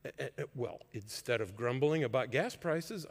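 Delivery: ordinary delivery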